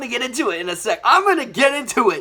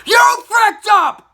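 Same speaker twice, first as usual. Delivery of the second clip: high, drawn-out yell; loud, slow outburst at full pitch; outraged shout; 11 semitones higher, 7 dB louder, much slower than usual. Acted. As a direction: shout it as a furious accusation. Outburst opening the film rant.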